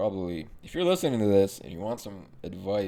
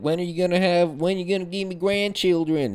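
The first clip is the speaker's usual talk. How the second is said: mock Texas accent; 9 semitones higher, 7 dB louder than usual. Mom nagging her child for grandchildren, mock Texas accent. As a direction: imitate a pestering mother in a Texas accent. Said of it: "not Texas. I don't know why the fuck I made that accent".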